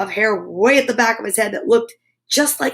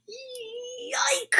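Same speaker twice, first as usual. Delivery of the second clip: very high, much softer squeal; high pained squeak; 12 semitones higher, 13 dB quieter than usual. Acted. Oliver squealing as Emily tugs his hair. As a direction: squeak from the tug.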